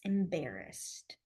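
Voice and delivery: low, soft and slow; hushed demo. Shown as a demonstration. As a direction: say it quietly while signing. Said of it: "brushing up your cheeks".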